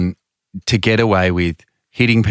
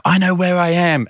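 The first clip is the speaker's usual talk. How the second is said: much higher, mock-offended protest; retold indignant insistence; 9 semitones higher than usual. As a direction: insist like a tipsy know-it-all.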